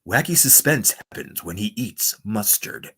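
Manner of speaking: suspenseful radio voice. Pretend. Streamer voicing a silly mustard promo, slow suspenseful announcer. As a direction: draw it out ominously for suspense.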